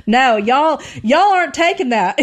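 a mock Deep South Alabama drawl; playful Alabama accent breaking into a laugh; Southern drawl. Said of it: "like, deep Alabamian drawls".

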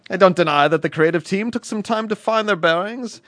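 put-on awful British accent. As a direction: ham up a posh British reading. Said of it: "just an awful, awful British accent".

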